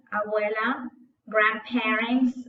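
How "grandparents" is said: slow drill word. Shown as a demonstration. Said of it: "vocabulary drill".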